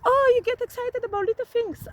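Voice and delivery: high-pitched mocking imitation. Imitating the ex-husband laughing at their excitement, mocking. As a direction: tease in a put-on high voice. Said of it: "used to laugh at me".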